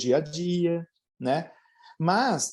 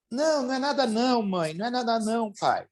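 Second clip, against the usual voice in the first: put-on higher, fast, dismissive child's reply; child brushing it off; 6 semitones higher, faster than usual. Child waving off mom and dad's question, higher and fast. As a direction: rush out a higher "it's nothing" like a teen hiding tears.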